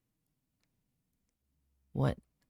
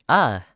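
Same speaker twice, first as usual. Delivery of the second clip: short loud yelp of alarm; a quick spooked cry; startled yelp; 8 dB louder than usual. Real, not acted. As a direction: jolt at a sudden scare. Reacts to creepy poem.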